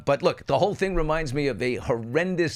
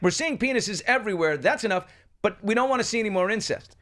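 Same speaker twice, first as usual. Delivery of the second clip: higher-pitched mock protest; repulsed; 7 semitones higher than usual. Voicing viewers put off by the storyline, mildly repulsed. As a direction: object as a fed-up audience would. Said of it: "people were repulsed by".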